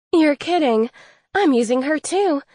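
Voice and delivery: a sudden delighted outburst; excited. Acted. The fairy thrilled to learn Emma uses the same matchmaker, excited outburst. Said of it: "In her excitement".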